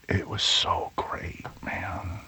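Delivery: soft, slow near-whisper; hushed awe. Real, not acted. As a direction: breathe it out, moved. Tender moment holding a baby.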